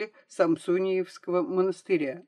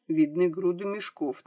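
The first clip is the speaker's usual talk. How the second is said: ordinary delivery, nothing marked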